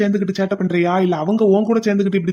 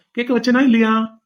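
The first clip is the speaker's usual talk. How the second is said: ordinary talk, nothing marked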